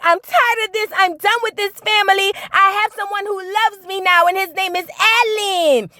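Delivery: high-pitched imitation of quitting the family; hammed-up high storming off; mock outburst. Daughter quitting her family, high mock outburst. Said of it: "she jumps up and she was like".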